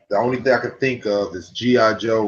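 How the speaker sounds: normal talking voice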